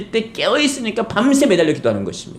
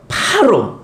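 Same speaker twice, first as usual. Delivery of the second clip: ordinary talk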